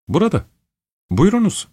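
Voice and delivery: bright high-pitched housewife welcoming a guest; midwife's voice. Acted. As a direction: pitch up high like a busy, eager landlady. Midwife answers the visitor.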